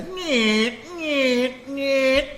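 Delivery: high, slow nasal nyeh nyeh nyeh; high motor whine imitation. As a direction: mimic a whining motor in a high, drawn-out voice. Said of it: "just an extra one there for you".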